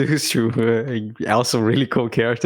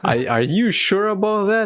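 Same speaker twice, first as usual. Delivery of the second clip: mock doubtful official; 8 semitones higher than usual.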